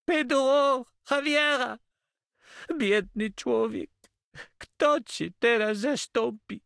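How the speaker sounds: very high, slow, drawn-out outcry; anguished cry. A narrator's reading. Moved Catalan recognises his fallen comrade, crying out. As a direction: cry out in lament, high and loud. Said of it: "a następnie wstał i krzyknął".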